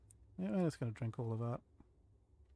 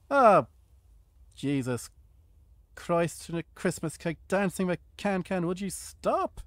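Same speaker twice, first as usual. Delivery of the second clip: loud, high exasperated rant; exasperated outburst; 5 semitones higher, 8 dB louder, slower than usual. Real, not acted. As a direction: blurt it out in exasperation. Zombie attack again.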